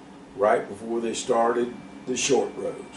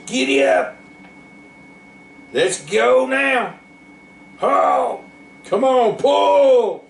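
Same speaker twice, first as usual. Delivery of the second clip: high, loud farmer's holler; driving the mules, high holler; 13 semitones higher, 4 dB louder, slower than usual. A narrator's reading. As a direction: call the team to pull, loud and high.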